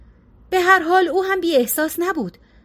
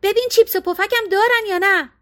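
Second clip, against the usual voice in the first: called out high, raised voice through the car window; wife calling after husband from the car window, raised voice; 7 semitones higher than usual. A narrator's reading. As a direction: shout it after him as he walks off. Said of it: "پشت سرش فریاد زد".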